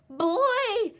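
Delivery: high, loud howling call. A narrator's reading. Little Wolf calling into the cave, loud howl. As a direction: howl it for everyone to hear. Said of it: "he howled".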